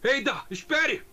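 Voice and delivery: high, drawn-out urgent call; dubbed hero's cry to wait; game hero calling out. Acted. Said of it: "Então manda um".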